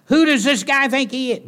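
high, loud indignant scoff; indignant scribes. Retold or imitated. Preacher voicing outraged scribes, loud indignant.

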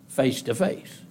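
ordinary talk, nothing marked

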